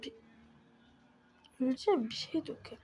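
ordinary delivery

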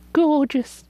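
usual speaking voice

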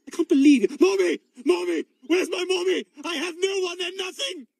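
put-on loud, high cries of a lost child; sudden panic. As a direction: wail for a parent in panic. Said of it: "and then panic because I can't see you".

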